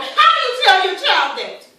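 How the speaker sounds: high, loud, desperate question; wounded mother crying out; anguished shout. Acted. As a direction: demand an answer in raw anguish. Mother's anguished outburst, question repeated.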